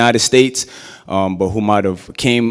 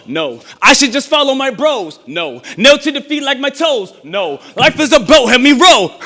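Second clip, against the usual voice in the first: rhythmic, rising poetry performance; spoken word with a chanted refrain; spoken word poem; 14 semitones higher, 3 dB louder than usual. Acted. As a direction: build it like a sermon in verse.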